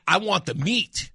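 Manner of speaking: ordinary talk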